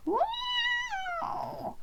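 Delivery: high frightened screech of a cat; a cat yowling in fright; shrill-pitched frightened cat yowl. A narrator's reading. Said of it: "The cat screeched a frightened".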